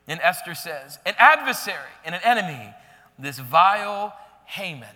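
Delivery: loud, weighty reading of Esther's accusation; scripture reading. Acted. Preacher reading Esther naming Haman, dramatic reading. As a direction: lean into the reading.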